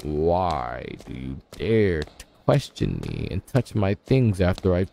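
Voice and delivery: low, slow, soft stern demand; stern deep voice. Acted. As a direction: interrogate like a grave master. Stern interrogation of character.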